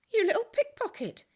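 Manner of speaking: a fond, laughing cry of surprise; laughing exclamation. A narrator's reading. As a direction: catch the thief with a laugh. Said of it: "he laughed".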